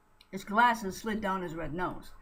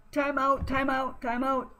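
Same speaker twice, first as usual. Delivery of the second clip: raised high urgent calls; calling time out; 5 semitones higher than usual. Acted. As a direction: shout for a halt.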